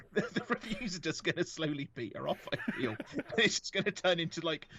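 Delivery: words through laughter; laughing while talking. Real, not acted. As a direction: talk through the giggles.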